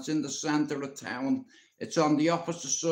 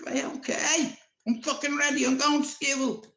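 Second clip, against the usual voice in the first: put-on much higher nervous boyish voice; scared boy; 12 semitones higher than usual. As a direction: placate her fast like a frightened kid. Boy placating raging granny.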